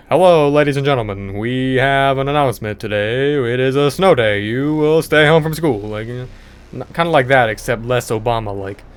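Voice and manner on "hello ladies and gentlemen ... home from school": put-on loud, formal old-timey announcement voice; imitating an old-fashioned gentleman's announcement; old-fashioned announcer. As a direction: announce it like a 1930s radio man. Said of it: "they talk like".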